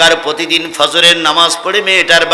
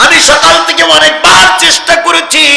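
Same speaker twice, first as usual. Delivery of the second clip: high, loud preaching cry; acted plea as a sermon shout; impassioned pleading; 11 semitones higher, 6 dB louder than usual. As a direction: plead it out high at full force. Abu Bakr pleads his case.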